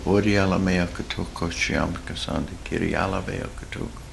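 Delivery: soft, low flowing tongues; murmured glossolalia; speaking in tongues. Shown as a demonstration. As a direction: pour out syllables quietly to God. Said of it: "just open your mouth and go".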